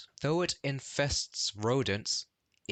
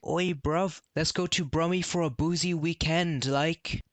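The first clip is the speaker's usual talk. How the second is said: higher, laddish London geezer voice; rough london slang; 7 semitones higher than usual. Retold or imitated. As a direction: play a cheeky Cockney lad egging his mate on. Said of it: "your mate's like".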